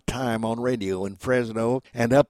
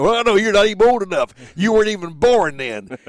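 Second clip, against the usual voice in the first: loud, higher burst of amused disbelief; surprised realization; 7 semitones higher, 9 dB louder than usual. Real, not acted. Veteran realizes the host is too young, loud surprised exclamation. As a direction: exclaim it as it dawns on you.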